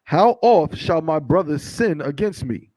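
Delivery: slow, measured reading aloud; scripture reading. Acted. Reader giving the congregation verses from Matthew, slow and declamatory. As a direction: read it out deliberately, word by word.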